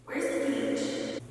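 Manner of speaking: slow, soft, breathy spaced-out whisper; drugged customer. Retold or imitated. High man asking the shop clerk for the beach, spaced-out whisper. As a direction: ask it dazed, barely voiced. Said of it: "and says".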